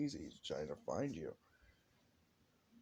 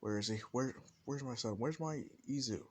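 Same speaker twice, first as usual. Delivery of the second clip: high, loud frantic calls; frantic mother calling; 7 semitones higher, 4 dB louder than usual. Acted. Mother bursting through the door searching for her son, loud and frantic. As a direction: burst in crying out for him.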